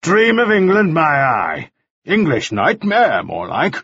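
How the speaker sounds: scoffing Mannering. A narrator's reading. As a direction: sneer at the name.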